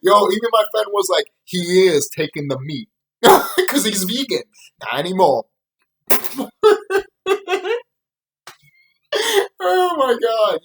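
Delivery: words squeezed through laughter; can barely speak for laughing; laughing while talking. Real, not acted. Vlogger retelling his friend's joke, laughing through it.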